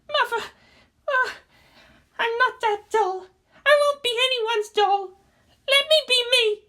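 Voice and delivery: put-on defiant young girl, rising; high girlish pleading. A narrator's reading.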